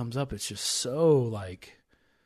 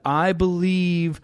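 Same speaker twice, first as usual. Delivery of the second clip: slow, loud, higher-pitched plea; crying out; 6 semitones higher, 7 dB louder, slower than usual. A narrator's reading. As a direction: cry it out, stretching each word. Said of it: "the father of the child cried out and said".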